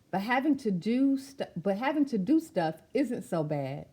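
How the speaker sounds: rhythmic, sing-song read of a picture-book poem; spoken-word storybook delivery; rhyming verse reading. Acted. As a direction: recite it with a steady bouncing beat.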